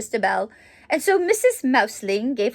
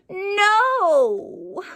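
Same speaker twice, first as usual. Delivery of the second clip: a loud, higher wailing no; childish shout; 8 semitones higher, 4 dB louder than usual. Acted. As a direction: shout it like an upset little boy. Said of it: "he shouted".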